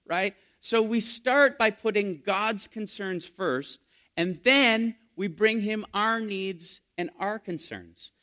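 usual speaking voice

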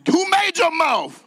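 shouted to a neighbour. Shown as a demonstration. Preacher shouting the Lord's question at the congregation. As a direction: shout it at the person beside you. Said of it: "Look at 'em and shout it".

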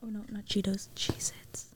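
soft close-mic whisper; ASMR whisper. Shown as a demonstration. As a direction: breathe the words into the mic. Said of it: "Should I do real A S M R?".

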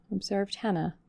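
plain reading voice

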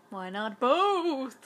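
excited echo. Real, not acted. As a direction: drag it out with glee. Echo, slow and loud.

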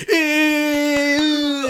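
long, high, loud "eww"; mock kids' squeal. Kids spot the teacher's girlfriend, teasing squeal.

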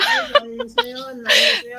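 high, loud, through laughter; laughing. Real, not acted. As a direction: talk while cracking up. Joking about missing family nagging. (natatawa habang nagsasalita)

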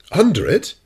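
loud, high astonished question; astonished exclamation. A narrator's reading. Professor suddenly grasps captain's project, astonished. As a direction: exclaim with pitch leaping up in amazement. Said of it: "I exclaimed, a sudden idea".

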